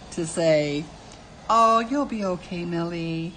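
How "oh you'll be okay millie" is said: facetious, condescending. Acted. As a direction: talk down with playful fake sympathy. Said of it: "facetious, condescending way".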